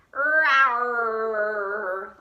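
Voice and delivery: loud storybook bear roar. A narrator's reading. Old Bear roars after losing at dreidel, a loud roar. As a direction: roar like a sore loser bear. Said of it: "Old Bear roared".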